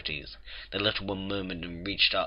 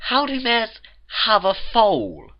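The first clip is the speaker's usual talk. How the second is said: high, loud, stretched-out incredulous question; exasperated demand; 14 semitones higher, 6 dB louder, slower than usual. A narrator's reading. Twilight challenges the Cakes' story, exasperated demand. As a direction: demand it word by word, slowing down.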